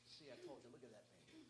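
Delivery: very soft, low murmur; quiet private gloating. Acted. Archer watching his arrow fly, murmuring to himself. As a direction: mutter it proudly under your breath.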